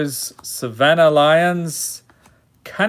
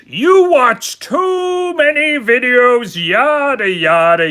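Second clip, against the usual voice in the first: high, loud, drawn-out put-on voice; hammed-up high voice; 9 semitones higher, 5 dB louder than usual. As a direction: stretch it out high and theatrical. Hammed-up card reading, comic mock chant.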